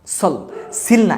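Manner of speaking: সল drawn out slowly, then সিল না; slow correction. Shown as a demonstration. Teacher slowly contrasting the endings for students. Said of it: "এটাকে আমরা সিল বলবো না".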